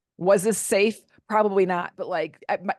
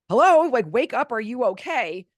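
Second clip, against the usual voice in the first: louder, higher call to rouse someone; hello, wake up, call it out over the music; calling him awake; 4 semitones higher, 6 dB louder than usual. Retold or imitated. Wife calling her passed-out husband awake, loud and high.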